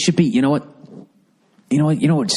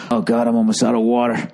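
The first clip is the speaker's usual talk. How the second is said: ordinary talk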